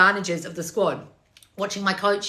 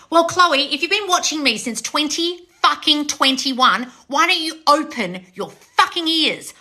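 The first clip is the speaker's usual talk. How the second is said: louder, higher, slow angry telling-off; exasperated scolding; 7 semitones higher, 4 dB louder, slower than usual. Real, not acted. Coach scolding commenter Chloe, loud and angry. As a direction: hammer each word at her.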